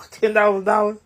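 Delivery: laughing. Acted. Giddy about the promised money.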